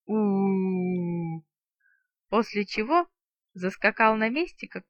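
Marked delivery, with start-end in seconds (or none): 0.1-1.4 slow eerie wailing oo; spooky howl. A narrator's reading. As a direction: howl like a spirit caller.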